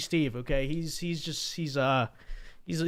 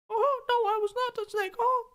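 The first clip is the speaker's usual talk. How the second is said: much higher squeaky cartoon-mouse voice, innocent denial; Dixie Steve voice. Puppet python denies being the Eden snake, squeaky cartoon voice. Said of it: "Dixie Steve, were you that snake?".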